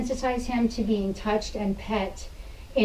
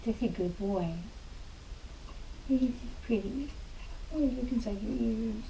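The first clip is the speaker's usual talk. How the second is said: soft praise; 12 dB quieter than usual. Real, not acted. Softly praising the dog while chewing.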